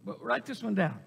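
usual speaking voice

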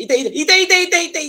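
high loud ow; loud mock pain. Shown as a demonstration. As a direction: yelp like hurt. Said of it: "kalau sakit".

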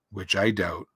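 low gloomy donkey voice; gloomy Eeyore. A narrator's reading. Donkey doubting it is a good morning, gloomy. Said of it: "said Eeyore gloomily".

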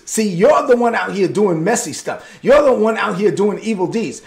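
ordinary talk, nothing marked

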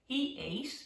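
plain reading voice

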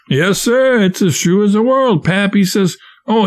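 put-on a frightened boy insisting to the raftsmen; eager protest. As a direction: plead that it is true. Scared boy protests his story.

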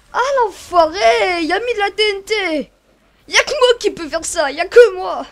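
loud outraged rant; outraged shouting. Real, not acted. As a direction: yell in outrage at the trap. Outraged by trap. (indignation criée)

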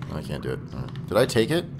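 normal talking voice

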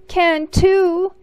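put-on high, loud childish shout; brother cries out. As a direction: fire it back at your sister. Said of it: "he cried".

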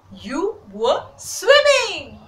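slow build to high, loud "swimming"; amazed exclaiming. A narrator's reading. Sparrow shows duck it swam, slow loud amazement. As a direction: draw it out in delighted amazement.

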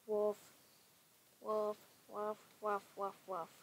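loud mock dog barks, over and over; dog barking. Gamer barks loudly at the dog-cat, playful.

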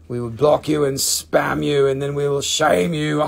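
joking threat breaking into a cackle; low mock menace into laughter. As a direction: threaten playfully, then laugh it off.

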